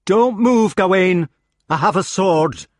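loud, high warning; alarmed threat. A narrator's reading. Agravain warns his brother off, hand on sword, alarmed. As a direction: warn him off urgently.

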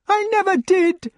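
very high, loud wailed denial; wailing. A narrator's reading. Lady denies adultery before Lancelot, wailing. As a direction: wail your innocence in a high lady's voice. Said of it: "wailed the lady".

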